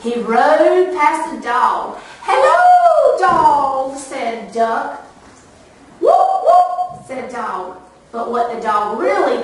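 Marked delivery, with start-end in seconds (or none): 2.2-3.9 high squeaky duck greeting; bright high duck hello. Acted. 6.0-7.1 dog voice. Acted. Dog's voice in story.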